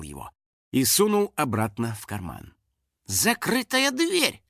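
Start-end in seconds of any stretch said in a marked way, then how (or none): 3.1-4.4 slow, high-pitched pondering; thoughtful drawl. A narrator's reading. Finik puzzles at a closed door, thoughtful drawl. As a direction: stretch the words out, musing high. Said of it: "задумчиво протянул Финик".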